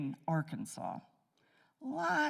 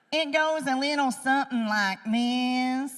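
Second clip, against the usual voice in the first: higher, louder West Virginia accent; childlike West Virginia accent demo; 6 semitones higher, 5 dB louder than usual. Shown as a demonstration. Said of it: "if you've never heard a four-year-old with a West Virginia accent".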